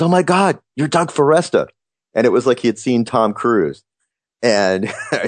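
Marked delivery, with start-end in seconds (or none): 0.0-1.7 high, gushing fan spotting a podcaster; starstruck fan. Retold or imitated. Fan in the bookstore recognizing the host, high starstruck gush. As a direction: gasp it out like you've spotted a celebrity. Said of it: "and he goes".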